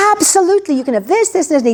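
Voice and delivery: high, eager, generous voice; eager Naaman. Retold or imitated. Healed guest happily giving gifts, in a high, eager voice. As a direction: gladly hand it all over. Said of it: "Naaman's like".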